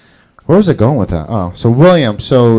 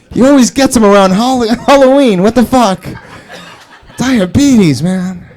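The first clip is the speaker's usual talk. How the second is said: high, incredulous, hammed-up outburst; mock alarmed gangster; 10 semitones higher, 3 dB louder than usual. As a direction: act aghast at a dreaded rival. Acted gang members' shocked reaction.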